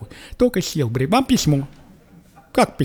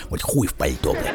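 mock bass reply; 13 semitones lower, 6 dB quieter than usual.

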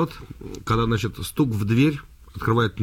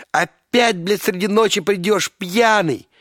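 higher, louder scolding wife; angry wife; 8 semitones higher, 3 dB louder, slower than usual. Acted. Wife scolding her husband leaving at night, angry. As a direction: nag him like a furious wife. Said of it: "Жена начинает злиться".

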